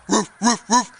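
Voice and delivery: three short imitated ruffs; dog barking. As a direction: show a pup how to bark. Mama demonstrates barking to George.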